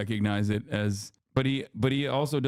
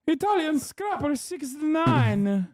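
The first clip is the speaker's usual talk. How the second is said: high, slow, hammed-up Italian accent; over-the-top Italian accent, high and drawn out; mock Italian accent; slower than usual. Shown as a demonstration. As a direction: stretch the name out in a cartoon Italian accent. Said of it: "you gotta read it with an Italian accent".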